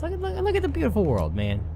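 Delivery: squeaky high, fast excited voice; silly high voice. Acted. Mock voice admiring world.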